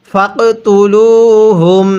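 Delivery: slow, drawn-out Quranic chant; measured tajweed recitation. Shown as a demonstration. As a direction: recite each word slowly and melodically.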